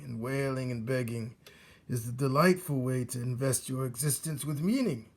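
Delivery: put-on a sneering old rodent; old rat Botticelli. Old rat mocks young rat's idea of light, sneering.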